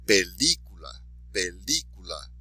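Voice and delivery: Película with "lí" stressed; stress on accented syllable. Shown as a demonstration. Teacher modelling for repetition, Película stressed on "lí". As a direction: lean on "lí" in Película. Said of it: "be sure to emphasize the accented syllable".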